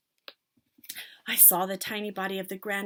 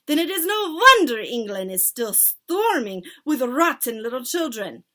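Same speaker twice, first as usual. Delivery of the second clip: loud furious witch roar; thundering; 5 semitones higher, 10 dB louder than usual. Acted. Witch leader scolding her followers, loud. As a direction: thunder at them. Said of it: "thundered the Grand High Witch".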